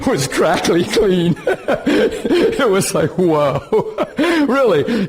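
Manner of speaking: real laughter breaking through the words; laughing. Real, not acted. Preacher recalling an amazing meeting to the congregation, laughing. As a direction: tell it through bursts of laughter. Laughs while telling the story.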